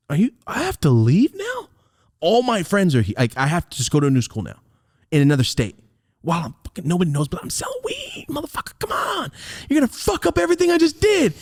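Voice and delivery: high, choked upset protest; high upset pleading. Retold or imitated. Teen begging his grandma not to send him away, high and upset. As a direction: plead near tears.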